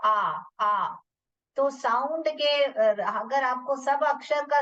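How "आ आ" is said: "आ" said as a long open vowel; vowel sound demo. Shown as a demonstration. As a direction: hold the open आ. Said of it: "उसका क्या sound है?". (खुला लंबा "आ")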